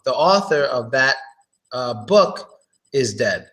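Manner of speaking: usual speaking voice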